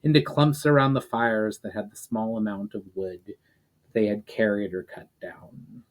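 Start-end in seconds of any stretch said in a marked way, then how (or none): none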